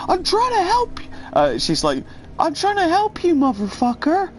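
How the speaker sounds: a high, frantic impression of the woman; high mock preacher woman. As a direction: squeak it like an unhinged street evangelist. Voicing meth-addled preacher woman.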